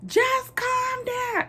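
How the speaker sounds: very high, slow mock yell; acted-out exasperated mother's shriek; imitating yelling mama. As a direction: stretch it out in a squealed scolding. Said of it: "when she was yelling".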